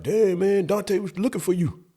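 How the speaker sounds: a hint of the gossipy classmate; classmate's voice.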